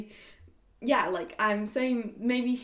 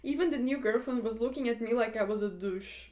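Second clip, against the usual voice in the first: normal talking voice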